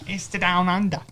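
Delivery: put-on playful Australian accent; mock Australian accent. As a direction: toss back a bad Australian accent. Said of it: "That was terrible".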